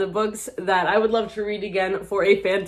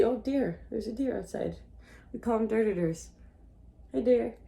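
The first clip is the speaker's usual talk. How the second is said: hushed aside; 5 semitones higher, 7 dB quieter than usual. Real, not acted. Spots a deer outside.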